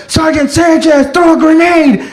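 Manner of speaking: high-pitched battlefield yell; urgent calling out. Acted. Soldier calls sergeant to throw a grenade, urgent yell. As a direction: call out an order under fire. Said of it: "somebody calling me to throw a grenade".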